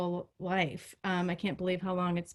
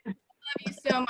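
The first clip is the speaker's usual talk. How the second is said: laughing; 5 semitones higher, 6 dB louder than usual. Real, not acted. Laughing through an emotional toast.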